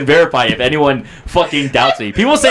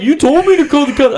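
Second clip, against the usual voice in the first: higher-pitched mock protest; defensive protest; 10 semitones higher than usual. Sister defending her call to the cops, high protest. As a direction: imitate an indignant sister, pitched up.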